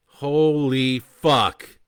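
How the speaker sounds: very loud, drawn-out curse; shout. Acted. Bo sees the missiles launch, a loud slow shout. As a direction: yell it at the rising missiles. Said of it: "Bo shouts".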